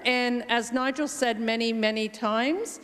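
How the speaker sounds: ordinary talk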